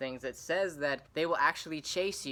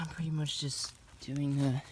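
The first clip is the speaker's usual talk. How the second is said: hushed whisper to the camera; 3 semitones higher than usual. Real, not acted. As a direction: whisper so you don't scare it. Quiet aside while hunting.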